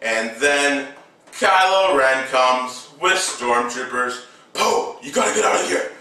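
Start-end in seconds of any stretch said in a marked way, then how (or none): 4.5-5.9 high, hurried mock warning; urgent warning. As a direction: rush out an urgent warning in a higher voice. Urgent warning voiced in retelling.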